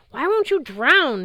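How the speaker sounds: high, loud, drawn-out wail. Acted. Sea god wailing at Percy who won't drown, a high slow wail. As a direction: wail it out in despair, slowly. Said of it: "he wailed".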